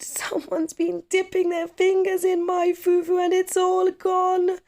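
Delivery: put-on very high, loud little boy's shriek; shrieking child. Kwaku finding his fufu gone, shrieking very high and loud. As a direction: wail it like a frightened small child.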